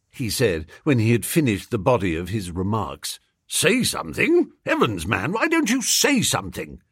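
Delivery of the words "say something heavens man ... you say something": high-pitched, mildly exasperated; exasperated demand. A narrator's reading. Freddy ranting at his silent friend, exasperated demand. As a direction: push for an answer, pitch jumping up.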